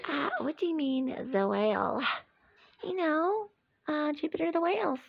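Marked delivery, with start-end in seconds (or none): 1.3-2.0 doubtful echo. Acted. Other toy echoes doubtfully.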